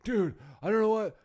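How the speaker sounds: high, loud, hammed-up distress; mock panic. Friend phoning about being kicked off ship, mock panic. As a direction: imitate a friend calling in a flap.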